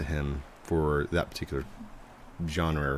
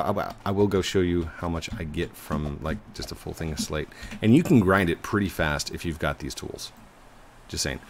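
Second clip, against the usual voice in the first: ordinary talk, nothing marked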